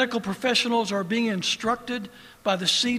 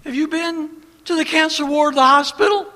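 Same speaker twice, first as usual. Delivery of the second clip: challenging question; 6 semitones higher, 8 dB louder than usual. Real, not acted. Challenging critics from pulpit.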